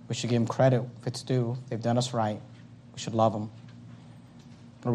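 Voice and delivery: soft, low closing prayer. Acted. Pastor leading the closing prayer, soft and low. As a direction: pray gently over the congregation.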